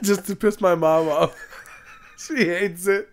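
laughing through the words. Real, not acted. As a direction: giggle while telling it. Laughing about annoying mom.

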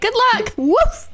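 high, giggly dog saying good luck; mock dog bidding farewell.

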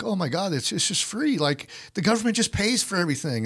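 high, airy mockery of someone naive; mocking gullible voice. Mocking people who think the tests are free, in a high naive voice.

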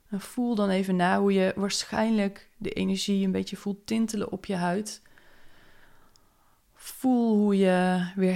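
calm meditation close; gently waking them back up; guided meditation. Acted. Brings listener out of meditation. (rustige meditatiestem)

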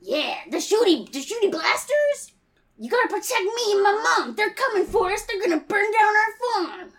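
put-on high, eager gee-whiz kid voice; wholesome 1950s kid. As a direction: plead like a scared farm boy. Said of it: "Golly gee, mister".

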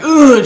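grunt. Retold or imitated. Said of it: "I'm like".

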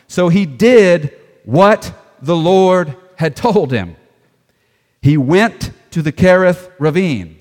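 slow, loud, word-by-word emphasis; scripture reading. Acted. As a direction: read it out with weighty pauses. Reads scripture aloud.

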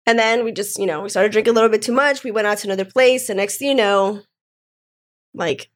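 ordinary talk, nothing marked

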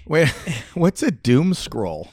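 laughing through the words; laughing question. Real, not acted. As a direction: ask while laughing.

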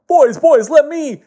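high, loud heroic call; booming high-pitched cartoon hero; eager hero. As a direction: burst in yelling to take over. Captain Underpants rushes in.

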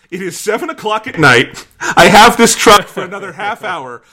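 loud, high, laughing exasperation; exasperated outburst. Real, not acted. Storyteller stressing to co-host the truck deadline, loud exasperation. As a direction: belt it out at the end of your rope. Exasperated recap of ticking clock.

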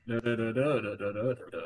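mock flat drone of nonsense syllables, a hint of the stern commander; monotone Zavala. Streamer mocks Zavala's serious delivery, mild monotone drone. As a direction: hum it out in one level tone. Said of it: "sound so monotone".

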